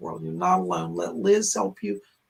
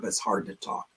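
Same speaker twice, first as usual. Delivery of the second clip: strained voice; 11 semitones lower, 5 dB quieter than usual. Real, not acted. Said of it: "Sorry for my raspy voice".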